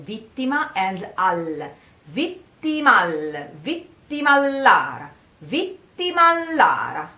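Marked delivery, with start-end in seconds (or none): 2.1-7.1 vittimallara run together, double L held; linked double L. Shown as a demonstration. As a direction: slide vittima into Lara with a long L. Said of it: "you just say one A between".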